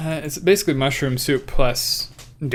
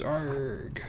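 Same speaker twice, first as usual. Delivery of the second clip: pirate voice; 10 dB quieter than usual. Acted. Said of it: "terrible at pirate voices".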